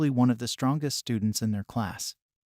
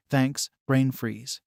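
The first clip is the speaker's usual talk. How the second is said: slow teasing; much slower than usual. Acted. Teasing friend after the match.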